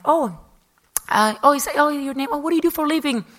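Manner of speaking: high, loud, put-on casual curiosity; hammed-up innocent small talk; pretending super cool. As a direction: act as if you have no idea who they are. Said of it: "you have to be super cool".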